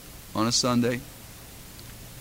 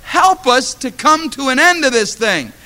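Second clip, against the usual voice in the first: pleading prayer; 11 semitones higher, 7 dB louder, slower than usual. Retold or imitated. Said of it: "I said".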